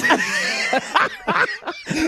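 high, helpless laughing; real laughter. Real, not acted. Musician laughing at host's joke about his song cards, real laughter. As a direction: burst out laughing, high and long.

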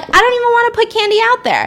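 high-pitched, hammed-up reluctance; mock whiny refusal; acted protest. Acted reluctance on Halloween night.

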